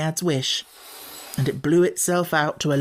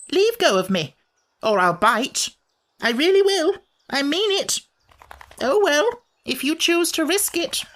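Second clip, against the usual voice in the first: high, louder warning squeal; creature threatening to bite; 13 semitones higher, 4 dB louder than usual. Acted. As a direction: snap a threat like a cornered little beast.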